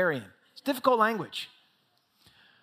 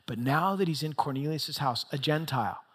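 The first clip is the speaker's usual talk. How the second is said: ordinary delivery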